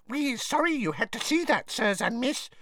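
high squeaky elf, ashamed and apologetic; lightly voiced sorry little elf; squeaking elf. A narrator's reading. A house elf apologises to the visitors, ashamed squeak. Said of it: "squeaked a nearby elf".

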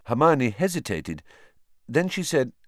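usual speaking voice

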